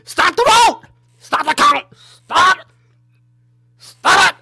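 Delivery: high, loud hammed-up chanting yells; mock protest shouting. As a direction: scream like a frantic protester.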